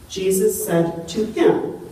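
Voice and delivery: "Jesus said to him" slow, rising on "to"; stress on TO HIM. Shown as a demonstration. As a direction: stretch "to him" out with a lift. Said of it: "The Bible actually says".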